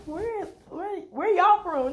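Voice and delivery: high, slow puzzled "where y'all from"; curious Californian. Retold or imitated. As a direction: turn around amazed at the accent. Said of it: "they just turn around".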